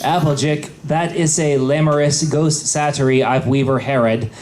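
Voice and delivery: ordinary delivery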